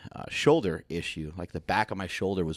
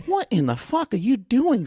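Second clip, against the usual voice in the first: much higher, louder and slow put-on voice; mock coach outrage; 5 dB louder than usual. Coach catching the speaker with light dumbbells, high mock voice. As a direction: drag out the coach's disgust. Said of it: "And he goes".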